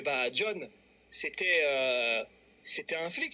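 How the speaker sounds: low, halting confession with a long "euh"; hesitant reveal. Retold or imitated. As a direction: stall before letting the secret out. Annonce hésitante, avant la répétition insistante. (aveu hésitant, grave et traînant)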